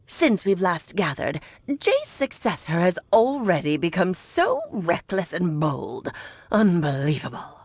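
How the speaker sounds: put-on low, haughty old woman; old hag impression. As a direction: imitate an imperious old hag. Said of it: "talking just like the old hag".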